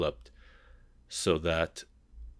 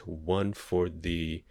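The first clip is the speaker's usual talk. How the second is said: ordinary talk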